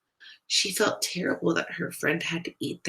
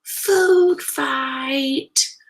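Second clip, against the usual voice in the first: loud, high, drawn-out rallying yell; 8 semitones higher, 6 dB louder than usual. A narrator's reading. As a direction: holler it across the whole lunchroom. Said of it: "Somebody yelled".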